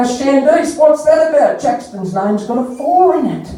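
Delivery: high-pitched, puzzled challenge; acted bafflement, voice lifted high; mock incredulous query. Acted challenge about misspelt name.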